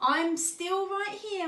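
high, slow, drawn-out motherly line; sighing reassurance. A narrator's reading. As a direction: reassure with a touch of a sigh. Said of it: "Mum sighed".

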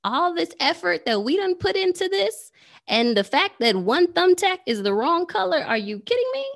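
mock incredulous squeak; inner disbelief. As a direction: react in your head, stunned. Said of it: "thinking to myself".

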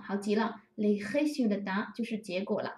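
normal talking voice

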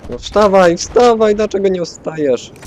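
high, loud urging; urgent shout. Real, not acted. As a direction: yell at a teammate to get up. Urging downed teammate to rise.

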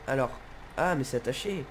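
ordinary delivery

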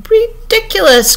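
high, loud, drawn-out exclamation; indignant cry. A narrator's reading. Red Queen cutting off Alice's argument, crying out. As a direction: cry it out, stretching the word high. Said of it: "cried the queen".

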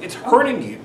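normal talking voice

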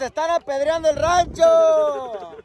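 high, slow drawn-out holler; alarmed sideline shout. Real, not acted. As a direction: holler that the team is under attack. Shouts as team is under attack. (grito alargado de comentarista)

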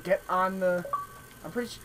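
ordinary delivery

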